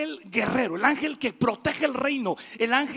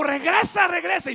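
higher, loud shout to come back; God calling Gabriel to return, high shout; 6 semitones higher, 4 dB louder than usual. Acted. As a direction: call him back urgently.

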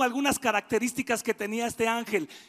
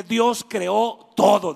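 each word pressed out, slow and loud; preacher modelling a line for the congregation, slow and loud; slow emphatic declaration; 4 semitones lower, 7 dB louder, much slower than usual. Shown as a demonstration. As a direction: proclaim it to your neighbour. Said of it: "voltees a tu vecino y dile".

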